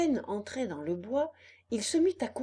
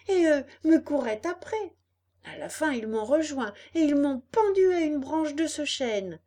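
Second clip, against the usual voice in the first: high, loud agitated account; excited puppet; 6 semitones higher, 6 dB louder than usual. Acted. Puppet recounts the chase to fox and cat, agitated. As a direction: keep it high and pressing, telling the ordeal.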